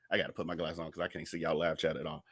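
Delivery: low, soft, quick aside; muttered aside. Real, not acted. Host tells viewers about needing glasses, quick low muttered aside. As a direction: drop your voice and rush it, half to yourself.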